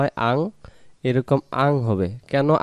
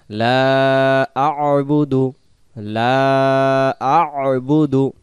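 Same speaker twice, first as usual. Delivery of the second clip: slow melodic tajweed chant; Quran recitation; 4 dB louder, much slower than usual. Shown as a demonstration. Teacher reciting the verse for students, slow chant. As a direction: recite slowly, stretching each vowel.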